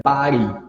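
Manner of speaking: body with a flapped t, like "bari"; American body. Shown as a demonstration. Teacher showing the student the American body. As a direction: say body the American way, t as a quick tap. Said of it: "American English".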